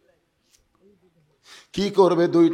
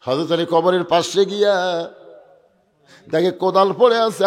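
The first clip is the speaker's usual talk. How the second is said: ordinary delivery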